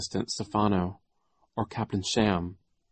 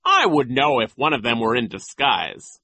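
loud, somewhat raised pitch; pompous, dismissive certainty; 4 semitones higher, 6 dB louder than usual. A narrator's reading. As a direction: wave the worry away. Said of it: "Nero said dismissively".

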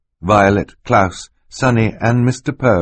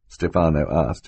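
ordinary delivery

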